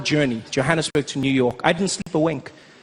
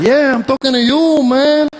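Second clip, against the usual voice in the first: higher, louder Mexican buddy; louder, higher Mexican-accented buddy voice; 6 semitones higher, 3 dB louder than usual. Retold or imitated. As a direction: claim him as one of his own kind. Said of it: "He said".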